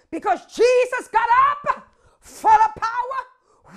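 shouted climax with drawn-out key phrases; full-force preaching. Real, not acted. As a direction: proclaim victory to the whole church.

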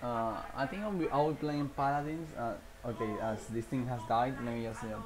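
ordinary talk, nothing marked

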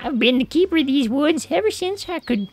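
put-on high, sweet old woman voice; a kindly old forest lady. Same old woman NPC.

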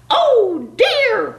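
high alarmed squawk; frightened cry. Acted. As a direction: cry out in dismay. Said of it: "cried the turkey".

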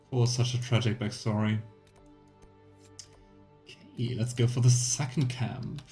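ordinary talk

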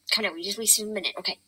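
usual speaking voice

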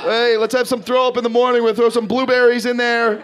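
lightly hammed-up cheery host; mock cheerful breakfast offer. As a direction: sell it brightly as a treat.

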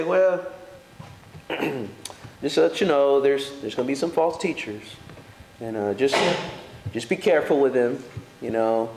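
low, offhand mock-casual Paul; a lightly voiced, too-relaxed apostle. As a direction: shrug it off as if it hardly matters. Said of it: "He didn't talk like that".

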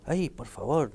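exaggerated mock despair; mock exasperation. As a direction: play up the theatrical dismay. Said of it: "Yo digo".

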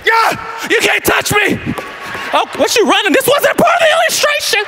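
very high, loud mock yell; playful yelling. Pastor running from Micaiah, yelling high. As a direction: shriek while being chased. Acted chase.

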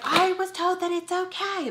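high-pitched mock yell; retold screaming. Yelling back through restroom door before opening it, mock scream. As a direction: holler defensively through the door. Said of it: "Like, screaming before I open it".

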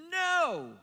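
sharp high no, punched out; emphatic no. Retold or imitated. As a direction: refuse flatly and firmly. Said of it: "declared emphatically".

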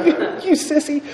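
high-pitched, stuttered teasing; mocking jeer. Man mocks Dave for crying as he speaks, high jeer. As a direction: make fun of a crier in a high voice.

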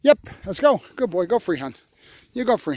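ordinary talk

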